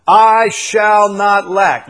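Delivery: loud, slow, emphatic declaration; bold loud confession. Shown as a demonstration. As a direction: proclaim it boldly. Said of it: "how bold a statement that is".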